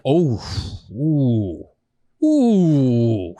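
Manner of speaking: pained oohs, then slow no bueno; mock wince of pain. Describing back pain to friend, mock wincing. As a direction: wince through the twinge.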